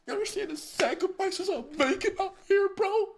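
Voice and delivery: high mock-tearful squeal, hammed-up; mock emotional. Streamer fakes tears over lost time to viewers, mock crying. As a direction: pretend to be choked up over the sacrifice. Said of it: "I get emotional".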